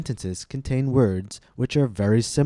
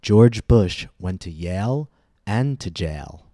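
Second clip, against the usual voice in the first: slow, deliberate pronunciation demo; careful model sentence; slower than usual. Shown as a demonstration. Teacher contrasts Yale and jail for learners, slow and careful. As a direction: say it slowly, keeping Yale and jail distinct. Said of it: "paying careful attention to the differences".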